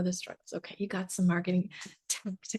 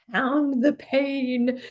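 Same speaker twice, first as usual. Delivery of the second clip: drawn-out, loud, higher mock slogan; mock pushy marketer; 5 semitones higher, 5 dB louder, much slower than usual. Coach mimicking gross old-style marketing, pushy and drawn-out. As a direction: hammer out each word slowly, mockingly.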